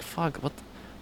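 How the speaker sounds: dismayed aside. Retold or imitated. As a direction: drop right down, as if cursing to yourself. Retold dismayed aside to himself.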